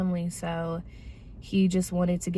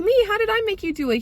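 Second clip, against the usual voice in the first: incredulous; 13 semitones higher, 3 dB louder, much faster than usual. Retold or imitated. Retold shock at being blamed.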